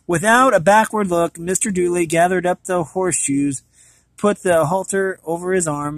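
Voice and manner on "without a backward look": plain reading voice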